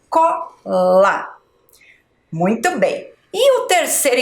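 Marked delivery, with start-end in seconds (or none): 0.1-1.3 "Cola" slowly split, CO, long LA; syllable by syllable. Shown as a demonstration. Teacher reading back the written word, "Cola" split up. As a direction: place each syllable of "Cola" separately. Joining syllables after spelling.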